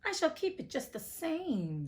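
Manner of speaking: high, slow witch gloat. Acted. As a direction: savour every word of the refusal. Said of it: "said the witch, laughing at her".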